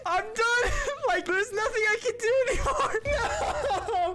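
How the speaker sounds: high, half-laughing, half-crying lament; despairing wail. Real, not acted. Player done for the game, high despairing wail. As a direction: wail in defeat, laughing through it.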